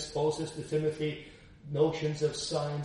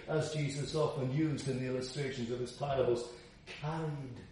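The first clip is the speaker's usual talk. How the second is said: ordinary talk, nothing marked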